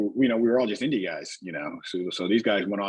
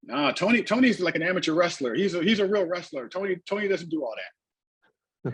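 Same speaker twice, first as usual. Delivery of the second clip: imitated promoter's reply; 8 semitones higher than usual. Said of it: "Rob looks at Abdullah and he goes".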